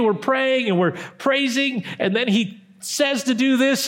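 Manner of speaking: usual speaking voice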